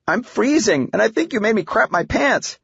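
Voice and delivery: put-on high-pitched, indignant girl; complaining daughter. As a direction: complain to dad in a high, worked-up voice. Freezing daughter complains to dad.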